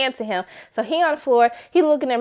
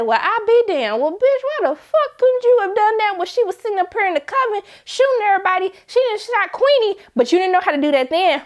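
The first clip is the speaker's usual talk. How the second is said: exasperated rant; 9 semitones higher than usual. Retold or imitated. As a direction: chew her out for waiting so long. Ranting at character who waited.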